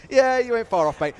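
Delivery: ordinary talk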